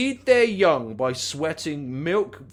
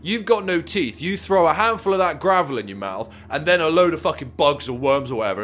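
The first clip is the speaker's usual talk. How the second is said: ordinary talk, nothing marked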